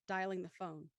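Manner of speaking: ordinary talk, nothing marked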